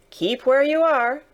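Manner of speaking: high, loud warning call; guard calling into the mist. Acted. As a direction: order a distant stranger to halt. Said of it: "the guard called to the voice in the mist".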